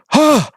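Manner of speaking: sharp, high startled gasp; terrified gasp. Acted. Man with a crossbow spots the players, terrified gasp. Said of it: "and looks terrified".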